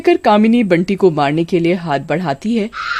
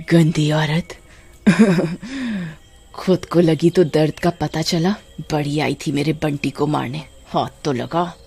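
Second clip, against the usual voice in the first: gloating witch; 3 semitones lower, 4 dB quieter than usual. Acted.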